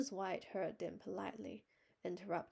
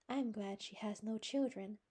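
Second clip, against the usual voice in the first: solemn; 4 semitones higher, 3 dB louder than usual. Acted. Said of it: "said Anne solemnly".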